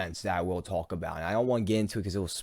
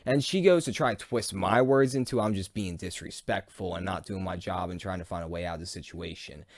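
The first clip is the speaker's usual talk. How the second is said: normal talking voice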